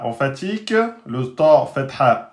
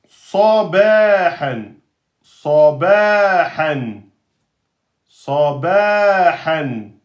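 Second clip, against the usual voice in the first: each Arabic word drawn out slowly, one at a time; slow word-by-word reading; much slower than usual. Shown as a demonstration. Teacher modelling slow word-by-word reading for students. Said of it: "c'est quand on lit mot par mot".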